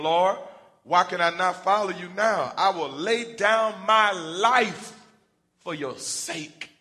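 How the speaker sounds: loud, high and drawn out, swearing loyalty; slow, loud vow of devotion; Peter's bold pledge. Acted. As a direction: declare it like a man ready to die for him.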